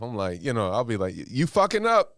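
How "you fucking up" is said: high, sharp self-reproach; scolding himself. Retold or imitated. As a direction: snap at yourself. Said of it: "I'm real hard on myself".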